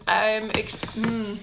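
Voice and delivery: ordinary talk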